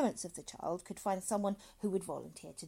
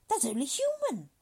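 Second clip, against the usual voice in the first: hearty doctor voice; 8 semitones higher, 9 dB louder than usual. A narrator's reading. Dr Hitz soothes.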